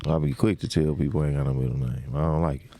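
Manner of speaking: normal talking voice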